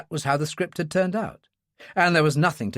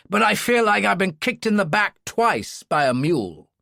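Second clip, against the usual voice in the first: hurting senator; 6 semitones higher than usual. Acted. Senator voiced after being shot.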